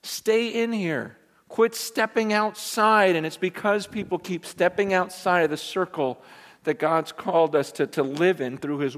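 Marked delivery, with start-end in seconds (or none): 0.0-3.2 gentle command. Retold or imitated. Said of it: "he says".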